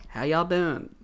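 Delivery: softly imitated Georgia country greeting; mock Southern mom's country accent. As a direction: put on a country Southern twang. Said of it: "pretty damn country accent".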